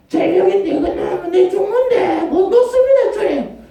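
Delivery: high, loud, laughing desperate cry; desperate pleading. Acted. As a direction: beg for your life, half laughing. Preacher acts desperate believer on cliff. (절벽에 매달린 신자의 다급한 외침)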